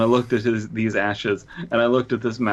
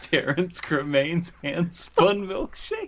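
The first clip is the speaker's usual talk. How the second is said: much higher, slow slogan reading; mock ad pitch; 9 semitones higher, slower than usual. Pitching Terrence's hand spun milkshakes, hammed-up ad voice. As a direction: announce it like a jingle tagline.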